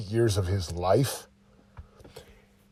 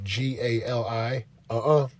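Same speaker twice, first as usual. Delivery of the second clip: normal talking voice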